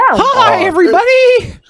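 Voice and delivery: squeaky host greeting; high silly voice. Pretend. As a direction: chirp it like a cartoon host. Mock show host greeting.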